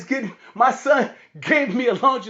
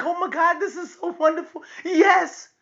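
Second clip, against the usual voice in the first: high, excited imitation of a thrilled girl; delighted gushing; 9 semitones higher than usual. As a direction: gush quickly in a high voice. Daughter's delighted reaction, acted out.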